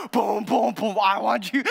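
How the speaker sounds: a hint of a stomping robot, punching each boom; march down the aisle like a machine; Iron Man bride. Groom mimics bride marching like Iron Man, mock robotic. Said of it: "like she's Iron Man".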